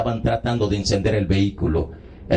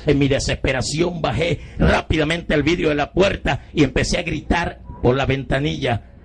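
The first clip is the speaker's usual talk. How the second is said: ordinary delivery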